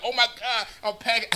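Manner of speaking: high, hushed mock panic; choked-up panic. Husband missing his medicine, imitating choked panic. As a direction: act out a choked, soft fretting. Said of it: "having a panic attack. I'm, like, choking".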